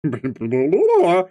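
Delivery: far-off odd voice, quickly dropped; wrong character voice. Acted. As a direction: try a voice that doesn't fit. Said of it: "that's surely not the correct voice for this".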